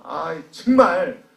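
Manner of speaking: drawn-out groan of words, then loud protest; exasperated complaint. Acted. Church worker lamenting a burdensome ministry, loudly exasperated. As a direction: drag out the exasperation, then burst out. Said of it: "마음에 불편함이 생길 수 있어요".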